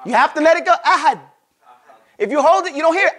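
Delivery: usual speaking voice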